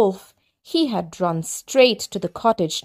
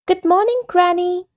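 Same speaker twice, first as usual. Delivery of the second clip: put-on much higher girlish greeting; little girl calling; 11 semitones higher than usual. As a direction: call out cheerfully to Granny. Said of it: "she cried".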